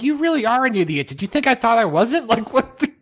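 high-pitched mock indignation; exasperated retort. Retort to the clueless technician.

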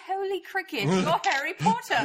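high, mock British exclamation with a laugh; a hint of British accent, laughing. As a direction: ham up a British cliché. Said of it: "They say".

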